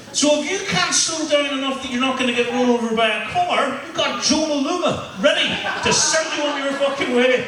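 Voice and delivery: high-pitched comic outrage; worked-up, exasperated stand-up tirade; incredulous rant. Acted. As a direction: rant in escalating disbelief, pitched well up. Comic outrage at cheese rolling.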